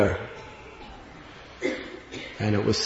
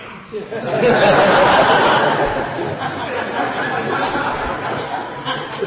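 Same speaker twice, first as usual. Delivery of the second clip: airy laugh; 12 semitones higher than usual. Retold or imitated. Laugh in the old man's reply.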